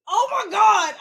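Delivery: loud, high cry of exasperation; real exasperated exclamation; exasperated outburst. Real, not acted. Venting about line jumpers at Queens.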